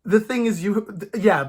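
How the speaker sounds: normal talking voice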